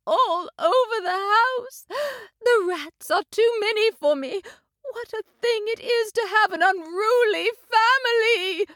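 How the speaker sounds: high, tearful complaint about her family; crying over her unruly kittens; distressed mother cat. A narrator's reading. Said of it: "said Missus Tabitha Twitchit".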